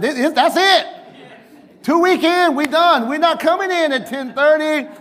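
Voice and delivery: fed up and done, declaring it final; indignant. Retold or imitated. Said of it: "I said".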